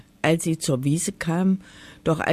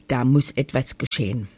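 ordinary delivery, nothing marked

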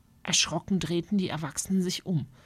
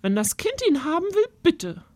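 put-on a higher, fussy old aunt giving in; resigned aunt; 11 semitones higher, 3 dB louder than usual. Aunt lets the child take the bird, resigned. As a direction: sigh and give way.

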